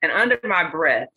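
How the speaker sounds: normal talking voice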